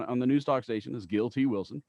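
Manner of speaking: ordinary talk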